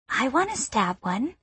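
put-on a small child asking eagerly; eager little girl. A narrator's reading. Fascinated girl asking the boy, small eager voice.

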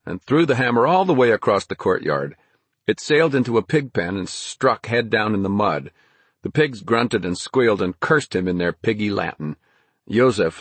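ordinary delivery

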